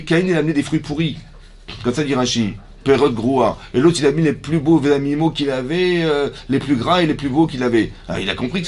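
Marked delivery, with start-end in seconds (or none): none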